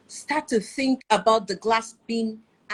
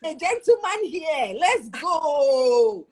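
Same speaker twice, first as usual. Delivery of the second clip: high, loud playful hype; hyped cheering; 5 semitones higher, 4 dB louder, much slower than usual. Retold or imitated. Wife hyping her gentleman husband, playful cheer. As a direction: cheer on your gentleman with gusto.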